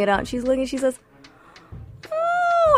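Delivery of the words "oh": very high, amazed little oh; high awed oh; grandma's gasp. Retold or imitated. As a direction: squeak it like a surprised grandma.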